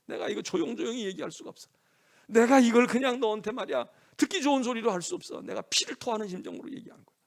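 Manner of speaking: high, loud, impassioned insistence. Acted. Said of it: "목소리가 높아지니까, 톤이 높아지니까".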